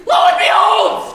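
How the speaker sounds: very high, loud, slow squeal. Acted. Pig two squeals in terror among the wreckage. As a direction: shriek it like a terrified piglet. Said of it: "squealed two".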